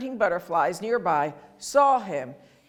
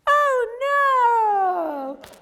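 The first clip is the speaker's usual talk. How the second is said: much higher, louder "Oh, no!"; mock alarm; 13 semitones higher, 4 dB louder than usual. Teacher feigning alarm at the caught frog. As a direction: gasp it out for the kids.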